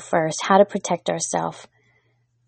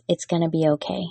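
soft reassurance; 3 semitones lower, 7 dB quieter, faster than usual. Pretend. God speaking comfort from the heart.

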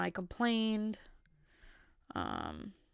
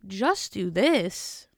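slow, louder, disbelieving question; mock baffled just do this; incredulous echo; 6 dB louder, much slower than usual. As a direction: repeat it back in disbelief.

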